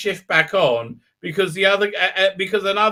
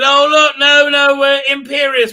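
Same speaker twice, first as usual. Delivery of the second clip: high, loud mock protest; hammed-up insistent objection, pitched up; 6 semitones higher, 6 dB louder than usual. Characters talking him out of it, mock protesting.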